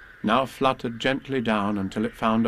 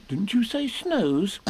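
high, slow small voice; puzzled Piglet; 9 semitones higher, slower than usual. Acted. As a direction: question it like a confused piglet.

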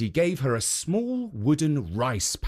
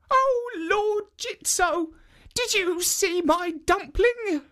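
put-on high, slow little old woman; high slow granny voice; slower than usual. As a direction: pipe it up like a sweet old granny. Old woman asks a statue.

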